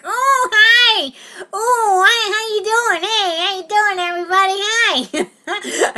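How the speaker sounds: very high, loud whiny greeting; Pat. Retold or imitated. Actor practicing costume on the bus, squealing character. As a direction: squeal hellos in a silly character voice. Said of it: "If I'm gonna be Pat".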